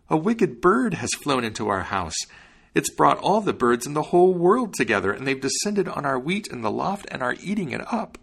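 high-pitched worried wife voice; high, plaintive complaint; distressed wife. A narrator's reading. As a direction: report the disaster high and dismayed.

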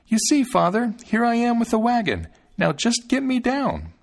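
put-on high, proud tiny-boy voice; Thumbling calling out. A narrator's reading. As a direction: call to father in a tiny high voice. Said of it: "called out to him".

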